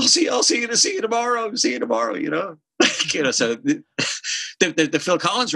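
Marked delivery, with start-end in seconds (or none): 0.0-2.2 high breezy farewell; lightly high sing-song see you tomorrow; imitating Elton. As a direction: toss off a high cheery goodbye. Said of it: "he was like".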